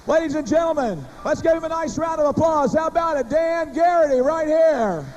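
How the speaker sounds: big arena announcer call; rally the crowd for applause. Acted.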